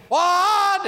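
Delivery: high, sharp mock outrage; indignant what. Student offended by the preacher's disagreement, high indignant outburst. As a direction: squeal the word in offended disbelief. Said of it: "fire almost came out his eyes".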